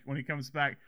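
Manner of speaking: normal talking voice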